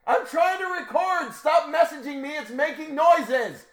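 high, loud yelling, dragged out; real shout. Real, not acted. Man yelling at his wife to stop messaging, loud shout. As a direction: yell at whoever keeps messaging. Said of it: "I heard you screaming".

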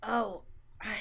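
ordinary talk

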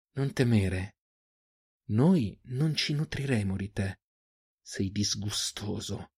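slow, hushed, threatening rasp; low, hoarse menace. A narrator's reading. Huntress to her wounded captive, low hoarse threat. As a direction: keep it soft and drawn out, full of threat. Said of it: "La voce di Eirin era bassa, rauca, minacciosa".

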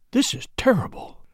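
whispered, barely voiced; low voice. A narrator's reading. Secretary sees the body, whispering. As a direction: breathe it out in shock. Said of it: "in a low voice".

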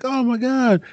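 high-pitched, hammed-up delighted greeting; excited greeting. Old coach greeting his former player, retold as excited high-pitched squeal.

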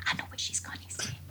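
breathy, voiceless whisper, leaning close; retold whisper. Billy Connolly whispering to [name] during the show, a hushed whisper. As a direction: hush it like a secret to the person beside you. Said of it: "He was whispering to me".

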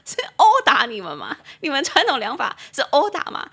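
high, loud imitation of a baffled friend; hammed-up disbelieving questions; mock incredulity. Friend asks about traditional therapy, imitated incredulity.

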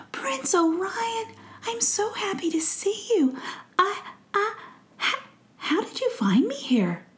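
high, faint fairy voice near tears; a frail fairy overwhelmed to see him; weak tearful fairy. Acted. Fairy Faith greeting the prince, weak and tearful. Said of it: "The music in her voice was not quite there".

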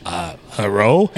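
a sheepish low "Uh, hello?" rising at the end; unsure guess. Retold or imitated. Retelling Adam's guess at a word, unsure low hello. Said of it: "And Adam pipes in".

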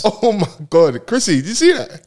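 slow, high gasp of disbelief; mock shock. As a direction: ham up the shock.

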